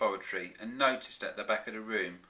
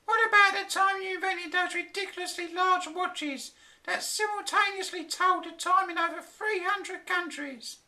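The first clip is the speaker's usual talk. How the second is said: high, louder exasperated scolding; exasperated wife; 4 dB louder than usual. A narrator's reading. Flora scolding her husband about time, high exasperated voice. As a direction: needle him, high and fed up. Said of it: "his angry wife".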